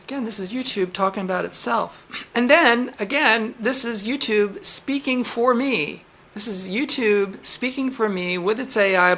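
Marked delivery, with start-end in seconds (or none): none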